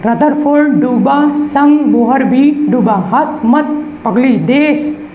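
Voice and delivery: slow, rhythmic sing-song chant of the memory trick; slow mnemonic recital. Shown as a demonstration. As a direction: spell out each phrase slowly so it sticks. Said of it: "आपको एक बार और दिखा दें".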